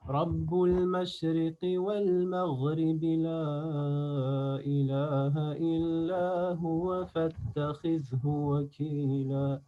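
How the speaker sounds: slow chanted verse on long held notes; Quran recitation. Shown as a demonstration. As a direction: melodic recitation with drawn-out vowels.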